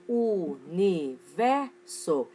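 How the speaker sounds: "universo" slow, in four separate syllables. Shown as a demonstration. Teacher reviewing four-syllable words for the class, syllable by syllable. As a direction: stretch "universo" as u, ni, ver, so. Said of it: "Palavras com quatro sílabas ou mais".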